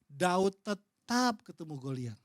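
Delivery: usual speaking voice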